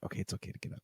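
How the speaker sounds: whispered to himself; soft unvoiced hush, not to wake anyone; whispered reassurance to himself. Pretending asleep, sneaking.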